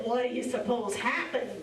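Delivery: ordinary delivery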